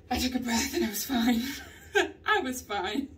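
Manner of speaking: laughing through the words. Real, not acted. After her pottery panic, laughing at herself. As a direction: giggle it out at yourself.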